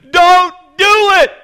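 high, very loud shouted warning; acted booming command at high pitch; urgent inner warning. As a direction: shout each word slowly. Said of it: "Now, it's a real quiet voice".

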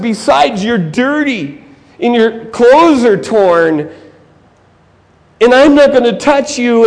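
high, slow, emphatic distaste; haughty acted refusal; disdainful preacher. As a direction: recoil from the dirty man. Preacher refusing to touch the victim.

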